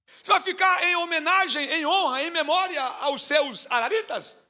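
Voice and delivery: high, loud doubting question; imagined doubter questioning Samar's reason for staying; skeptical challenge. Retold or imitated. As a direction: push back with disbelief.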